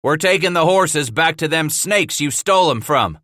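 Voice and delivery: a shouted challenge at the gate. A narrator's reading. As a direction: call it out hard to the thieves. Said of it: "Titus shouted".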